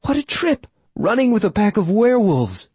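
put-on high, delighted young woman; young woman crowing. A narrator's reading. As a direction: crow with giddy excitement. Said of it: "she crowed".

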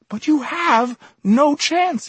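very high, slow, loud exclamation; exasperated, high-pitched outburst; agitated insistence. A narrator's reading. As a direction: cry it out, stretching each word. Emerson exclaims against the visitor's plea.

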